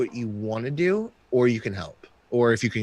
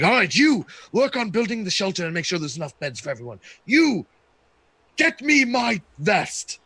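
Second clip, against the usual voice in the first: bossy chieftain; 7 semitones higher than usual. Acted. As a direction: bark orders like a power-drunk chief. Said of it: "He's like".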